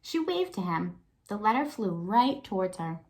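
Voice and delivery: put-on lively read-aloud for young kids; story narration. A narrator's reading. Teacher reads picture book aloud.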